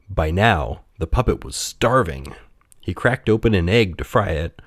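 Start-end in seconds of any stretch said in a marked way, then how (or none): none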